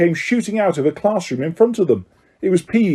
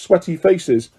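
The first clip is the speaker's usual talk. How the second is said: plain reading voice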